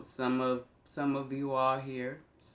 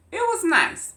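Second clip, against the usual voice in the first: "it was nice" pitched way up and loud, mock bright; sarcastic "nice"; 10 dB louder than usual. Real, not acted. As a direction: sing out "was" high, then drop it sarcastically. Sarcastic repeat of holiday remark.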